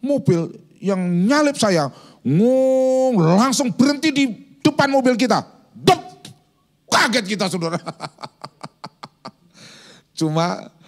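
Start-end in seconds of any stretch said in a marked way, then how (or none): 2.2-3.2 high, nasal-free mock engine noise, a touch louder; playful imitated car noise; car engine vroom. 5.8-6.2 sharp high mock brake noise; playful sound effect of a car halting; sudden stop sound. Imitating car stopping abruptly.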